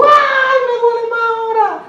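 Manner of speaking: put-on high, loud childish wail of pain; girl's pained cry. Pastor voicing the girl complaining the pain got worse, high loud wail. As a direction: cry out like a hurting little girl. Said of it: "Y la niña dijo".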